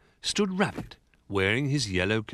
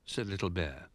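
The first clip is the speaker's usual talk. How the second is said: ordinary delivery, nothing marked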